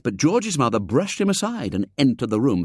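usual speaking voice

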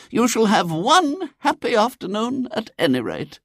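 very high-pitched lady's voice; consoling lady. A narrator's reading. Lady comforts William, consoling and high. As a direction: console the poor child.

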